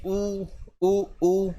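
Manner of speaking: drawn-out ऊ with lips nearly closed; vowel demo. Shown as a demonstration. Teacher showing the class closed vowels, sounding ऊ carefully. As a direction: sound out ऊ slowly, lips almost shut. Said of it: "आधा भी नहीं खोलना पड़ता".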